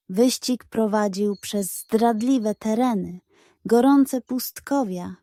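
ordinary delivery, nothing marked

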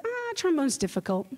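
high, soothing mock teacher voice; condescending teacher. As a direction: pat the kid on the head with your voice. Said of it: "your teacher looks at you and says".

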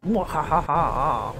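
low, slow mock villain cackle; drawn-out villainous mwahaha; evil laugh.

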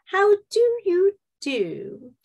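put-on high, slow Mid-Atlantic old Hollywood star; grand old Hollywood Mid-Atlantic greeting; Mid-Atlantic accent. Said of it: "today I am speaking with the Mid-Atlantic accent again".

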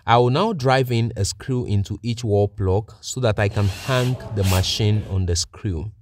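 ordinary talk

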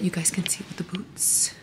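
soft unvoiced whisper. Real, not acted. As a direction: breathe it without voice. Quietly adjusting the camera.

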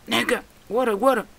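high, loud put-on repetition; mock-panicked high cries; mimicked yelling. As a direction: imitate a frantic call for water. Repeats imitated catchphrase.

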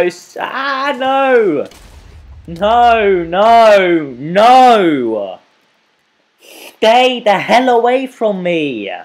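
high, very loud wailing yells; long drawn-out howls of protest; desperate screaming. Real, not acted. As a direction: scream it out, stretching every word. Screams while being killed in game.

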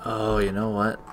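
ordinary delivery

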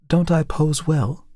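put-on a touch of a worried young woman; Tessie, young model. A narrator's reading.

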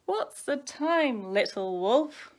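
slow, loud singsong pestering; whining. A narrator's reading. Baby wolf asks brother for dinner time, whining. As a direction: pester in a drawn-out childish whine. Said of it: "whined Smells".

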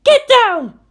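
urgent shouted warning; yelled warning. A narrator's reading. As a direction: scream at him to duck. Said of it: "yelled right at Shinji's face".

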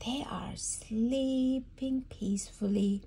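hushed whisper. Real, not acted. Mother whispering about sleeping animals. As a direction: whisper so they stay asleep. Animals asleep behind door.

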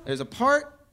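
ordinary talk, nothing marked

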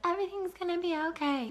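high, quick, breezy mock cheer; mock someone ignoring the cliff edge; mock blind optimism; groundless optimism. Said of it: "groundless optimism".

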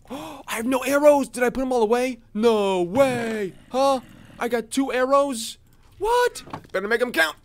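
sudden alarm at an empty quiver; startled panic. Real, not acted. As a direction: caught out with no arrows. Surprised to find no arrows.

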